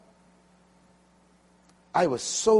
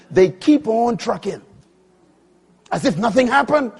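full-force preaching; 7 semitones higher, 8 dB louder than usual. Acted. Preacher warning the congregation, full-force preaching. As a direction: drive it home from the pulpit.

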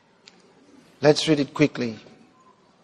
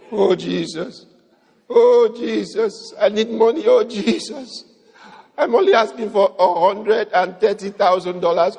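mock begging prayer. Preacher mocking believers who beg Jesus for money, hammed-up whining plea. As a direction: beg like a needy child for money. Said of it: "He won't talk like that for you".

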